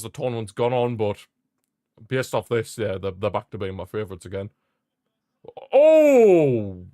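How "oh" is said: sudden loud high gasp of surprise; yelped out as Mbappe nearly scores; startled cry. Real, not acted. Commentator yelps in surprise at a near miss.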